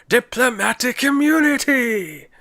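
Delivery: high, slow, shouted South African villain; South African accent. Retold or imitated. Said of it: "the guy from Lethal Weapon two going".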